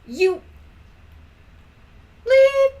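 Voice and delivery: hammed-up furious command to leave; angry shout. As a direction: throw the boyfriend out at full cry. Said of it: "I shouted angrily".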